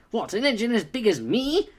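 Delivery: hammed-up pompous engine; overemphasized Gordon. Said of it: "it's like".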